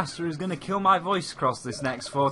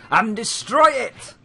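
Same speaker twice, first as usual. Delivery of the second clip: commanding shout; 6 semitones higher, 5 dB louder than usual. Acted. Villain commanding in game.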